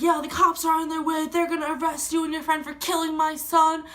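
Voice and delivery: mock angry yell, pitched high; screaming accusation. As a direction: imitate a freaked-out dad making threats. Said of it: "screaming in my face".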